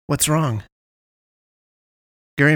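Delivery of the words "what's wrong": raised, higher call across the park; worried call after Dart; calling after him. A narrator's reading. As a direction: call out to a friend running off. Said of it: "calling after him".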